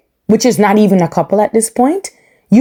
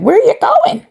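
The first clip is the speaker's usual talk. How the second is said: mock therapist. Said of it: "Doctor Pepper was like".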